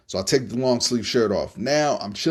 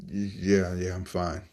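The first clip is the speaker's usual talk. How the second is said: groggy; 4 semitones lower, 6 dB quieter, slower than usual. Retold or imitated. Groggy reply to doctor.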